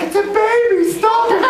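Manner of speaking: hammed-up high-pitched scolding; exasperated wife. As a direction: imitate a wife cutting off the theatrics. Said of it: "my wife's like".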